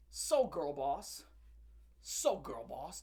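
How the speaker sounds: slow, low, deadpan mock praise; sarcastic. Real, not acted. Mocking the comedian's photo pose, slow sarcasm. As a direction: drag it out with dry sarcasm.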